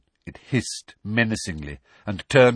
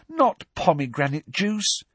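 moaning; 9 semitones higher than usual. Acted. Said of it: "Hermione moaned".

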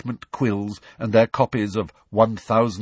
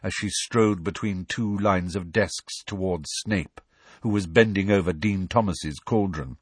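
ordinary delivery

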